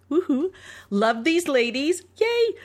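little celebratory whoop; mock cheer. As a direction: cheer for ourselves playfully. Cheering own review.

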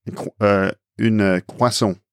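croissant in a French accent, silent T; French "kwa-son" for croissant; French pronunciation. Shown as a demonstration. Asked how to say the word, French accent. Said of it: "how do you say that word".

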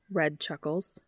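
ordinary delivery

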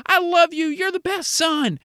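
high-pitched, gushing mother voice; mom beside herself. Acted. Mom praising little brother for breakfast, high and overjoyed. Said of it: "your mom is beside herself".

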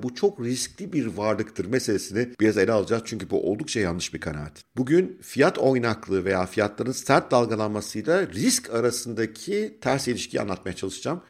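ordinary talk

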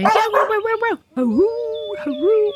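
a tiny dog acting tough; hammed-up little hound bark. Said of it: "with her little hound bark".

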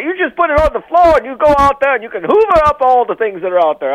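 hammed-up British gent, pitch swooping high; bad British accent. Shown as a demonstration. Co-host showing Marshall a terrible attempt, hammed-up British accent. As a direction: put on an exaggerated, jolly British accent. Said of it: "try to do any particular accent".

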